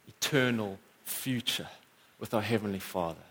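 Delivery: normal talking voice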